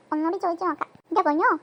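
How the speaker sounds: ordinary talk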